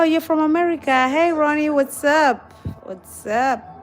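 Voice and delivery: ordinary talk, nothing marked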